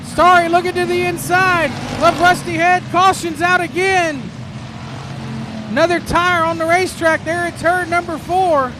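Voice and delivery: lively live race call; motorsport commentator. Real, not acted. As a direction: call the laps as they unfold.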